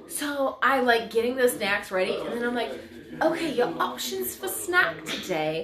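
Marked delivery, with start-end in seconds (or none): 3.2-5.6 high, theatrical British teacher; full method-acted British, pitched up; mock British accent. Teacher offering snacks to her class, put-on British accent. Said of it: "she went into character when talking to her students".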